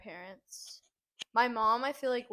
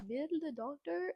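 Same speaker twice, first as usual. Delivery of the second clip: put-on slow, higher Greek grandma accent; 6 semitones higher than usual. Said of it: "her Greek accent and be like".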